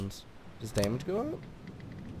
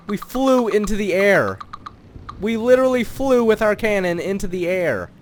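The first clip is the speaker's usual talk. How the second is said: raised, louder, marvelling voice; amazed emphasis; 6 semitones higher, 6 dB louder than usual. Real, not acted. Gamer marvelling at flying with the cannon, amazed emphasis. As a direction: lean on the words in happy disbelief. Retelling the launch, still amazed.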